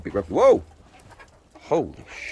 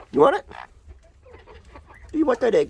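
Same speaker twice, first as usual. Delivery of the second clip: pet talk; 12 semitones higher, 4 dB louder, faster than usual. Acted. Offering a hen an egg.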